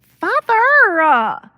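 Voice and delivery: put-on a spoiled girl stunned and outraged; shocked protest. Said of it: "could not believe her ears".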